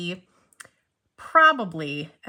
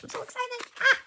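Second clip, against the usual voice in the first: excited squeal; faster than usual. Real, not acted. Unboxing a new pen for viewers, a high excited squeal. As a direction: squeak it out, too thrilled to hold back. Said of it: "I'm getting very excited".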